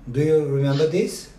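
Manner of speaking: ordinary delivery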